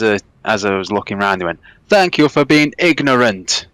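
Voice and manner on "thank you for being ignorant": loud, higher, slow mock Russian; imitated Russian accent. Russian stranger telling off a passenger who ignored him, mock Russian accent. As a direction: scold slowly in a put-on Russian accent. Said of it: "a crazy Russian guy on the train".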